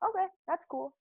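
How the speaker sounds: high, easygoing "okay, that's cool"; breezy acceptance. Retold or imitated. Friends shrugging off her seizure news, breezy and unbothered. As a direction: shrug it off lightly, no big deal.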